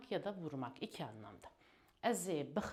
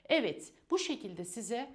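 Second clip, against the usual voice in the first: upbeat wrap-up; 4 semitones higher, 8 dB louder than usual. Real, not acted. Teacher wraps up the lesson.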